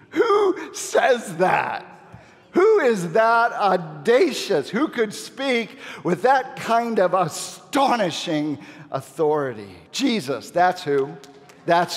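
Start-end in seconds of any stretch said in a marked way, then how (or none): 0.1-9.8 high, drawn-out astonished questions; awestruck incredulity. Acted. Preacher marvels at who could speak so, incredulous. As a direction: marvel at the audacity, stretching the words.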